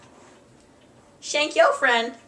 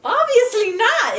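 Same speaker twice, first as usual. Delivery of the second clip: exasperated; 12 semitones higher, 6 dB louder than usual. Real, not acted. Exasperated answer.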